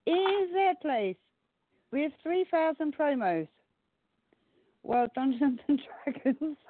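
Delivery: high, slow dramatic announcement; slow announcer voice. Acted. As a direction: stretch it for suspense. Announcing ranking results.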